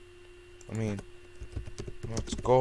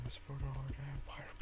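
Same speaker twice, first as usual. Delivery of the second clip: whisper; 3 semitones higher, 15 dB quieter than usual. Pretend. Mock battle cry in game.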